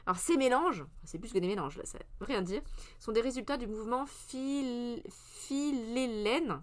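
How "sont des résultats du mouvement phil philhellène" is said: "philhellène" articulé très lentement, syllabe par syllabe; détacher lentement phil-hel-lène; prononciation soignée. Shown as a demonstration. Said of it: "c'est comme ça qu'on prononce". ("philhellène" prononcé très lentement et avec soin)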